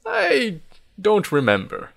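high, wavering pitch on a hesitant answer; forgetful old man. Acted. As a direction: admit it haltingly.

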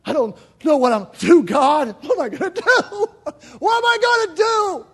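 loud, high, anguished pleading; despairing cry. Acted. Grieving believer crying out to God, loud despair.

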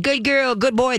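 put-on high, gushing good girl good boy; revved-up praise that excites the dog; excited praise. Shown as a demonstration. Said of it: "But if you keep saying".

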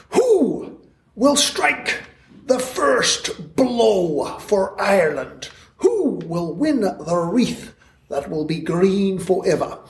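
high, slow, impassioned rallying cry; slow, soaring patriotic call to arms; stirring recitation. Acted. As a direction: declaim it like a rebel poet rousing Ireland. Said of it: "wrote the immortal words".

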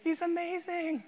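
high, breathy mock swoon; hammed-up girlish gush over Ryan Gosling; swooning fan. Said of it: "you're going".

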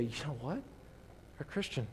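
hushed, near-whispered observation; quiet, slow aside, nearly whispered; natural, understated. Acted. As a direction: say it very softly, as a private realization. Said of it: "it should just be natural".

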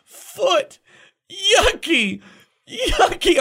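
high-pitched imitation of a toddler; crying toddler. Messy son complaining to dad in bath, toddler whine. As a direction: imitate a distressed two-year-old, voice way up. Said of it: "he's crying and he just keeps looking at me and going".

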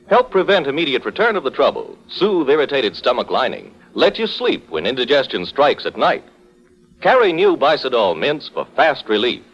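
normal talking voice

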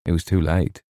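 ordinary delivery

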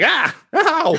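high sharp cry of pain; pained yelp. Acted. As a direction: yelp like you just got hit. NPC smacked, cries out.

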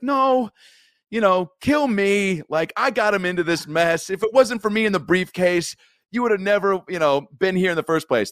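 high pleading movie voice; Jim Carrey impression. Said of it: "Jim Carrey's like".

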